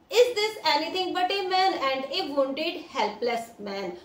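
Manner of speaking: slow, careful read-aloud of the English text; deliberate classroom story reading. A narrator's reading. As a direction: read each word out slowly for the class.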